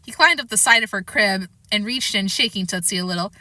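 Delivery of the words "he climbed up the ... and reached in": usual speaking voice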